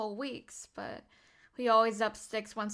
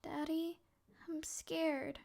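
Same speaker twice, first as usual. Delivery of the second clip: very soft, higher, timid voice; scared child; 12 semitones higher, 15 dB quieter, much slower than usual. Acted. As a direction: say it small and scared to Daddy. Child afraid of being shot.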